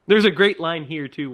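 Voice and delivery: normal talking voice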